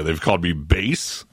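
ordinary delivery

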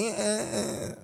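mock creaky fry, very soft; vocal fry. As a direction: croak it out, barely voiced. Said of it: "tripping about Tina's vocal fry".

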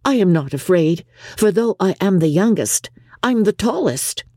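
put-on high-pitched, self-assured young girl; stout confidence. A narrator's reading. Youngest daughter assuring mother she isn't afraid, stoutly. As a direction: boast it stoutly in a high girlish voice. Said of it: "said Lydia stoutly".

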